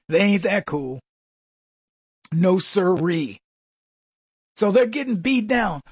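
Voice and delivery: ordinary talk, nothing marked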